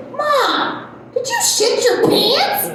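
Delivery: high, slow, disbelieving son voice; the kid asking, appalled; teen son's blurted question. Retold or imitated. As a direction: son calling out to mom in the car, high and slow. Son voiced asking mid-drive.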